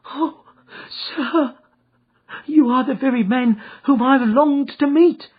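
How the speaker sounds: eager cry. Acted. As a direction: cry out in relief. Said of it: "cried the little fellow".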